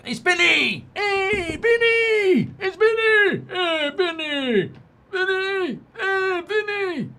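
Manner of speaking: very high, loud drawn-out calls; hammed-up shouting. Sports streamer calls out to Vinny, hammed-up high shouting. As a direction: holler it in a piercing put-on pitch.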